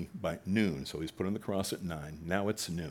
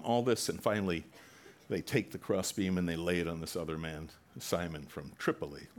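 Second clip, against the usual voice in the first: ordinary delivery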